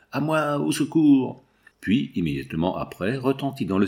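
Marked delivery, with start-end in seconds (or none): 0.1-1.4 slow, higher call for help. A narrator's reading. Engineer calls for help as he is attacked, slow higher cry. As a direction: cry out into the night for rescue. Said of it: "cet appel".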